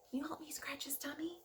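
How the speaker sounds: quick unvoiced whisper. Teacher asking kids to tickle Larry, whispering. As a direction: ask the kids in a hurried hush.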